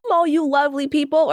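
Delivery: usual speaking voice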